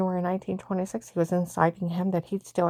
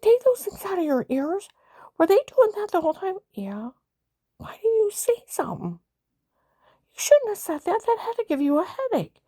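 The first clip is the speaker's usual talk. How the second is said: high urgent quote; 11 semitones higher, 3 dB louder than usual. Retold or imitated. Urgent reported talk to husband.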